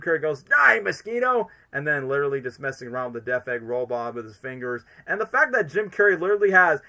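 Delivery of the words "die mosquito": high, drawn-out villain cry; villain impression. Imitates a film villain's line.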